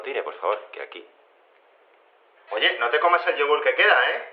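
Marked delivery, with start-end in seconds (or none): 2.5-4.2 loud warning from across the room; calling out. Acted. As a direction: shout at the clone to leave the yogurt. Shouting at clone off phone. (grita al clon que no se coma el yogur)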